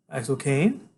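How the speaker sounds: ordinary delivery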